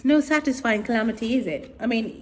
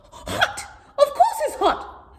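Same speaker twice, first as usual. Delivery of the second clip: scolding sister; 3 dB louder than usual. Acted. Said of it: "her sister Madge said".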